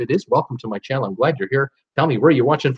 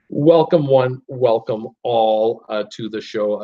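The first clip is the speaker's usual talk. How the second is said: drawn-out showman greeting, stretched slow; showman welcome; 4 semitones lower, slower than usual. Pretend. Host greeting live-stream viewers, slow stretched showman call. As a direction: welcome them in like a ringmaster, slowly.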